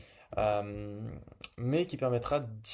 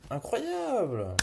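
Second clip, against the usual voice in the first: high, drawn-out cry of amazement; amazed; 10 semitones higher, 5 dB louder, much slower than usual. Real, not acted. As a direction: marvel out loud at the result. Amazed by the AI's answer.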